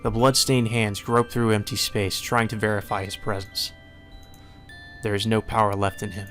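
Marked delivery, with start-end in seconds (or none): none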